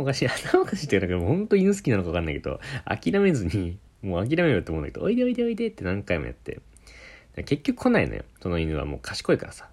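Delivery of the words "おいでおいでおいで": much higher coaxing call to a dog; mock coaxing aunt. Aunt beckoning the dog through the mirror, high mock coaxing.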